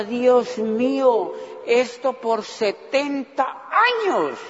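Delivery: very slow drawn-out lament; hammed-up weary despair; mock despair. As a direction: groan at the endless years. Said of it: "Y yo decía".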